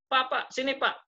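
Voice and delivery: voicing someone beckoning "Pak"; calling out to a man. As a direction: call him over briskly.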